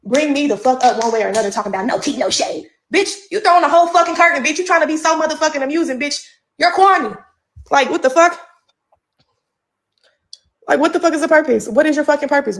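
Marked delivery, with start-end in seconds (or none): 2.9-7.2 higher and louder, calling her out; heated rant. Real, not acted. Live rant to followers, calling out a rival loudly. Ranting at another woman online. 7.7-12.6 higher, louder what the fuck; exasperated outburst. Real, not acted. Vlogger to her rival, loud and exasperated demand.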